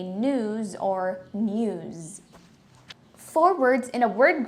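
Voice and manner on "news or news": news as NOOZ, then as NYOOZ; two pronunciations. Shown as a demonstration. Teacher recapping both ways, contrasting pronunciations. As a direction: draw out news, first without the y glide, then with it. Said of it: "So you can say".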